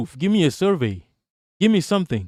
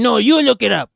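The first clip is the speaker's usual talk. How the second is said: higher, louder, brushing-off mock reply; mock dismissive retort; 6 semitones higher, 5 dB louder than usual. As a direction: toss it back smugly, imitating them. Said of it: "And then".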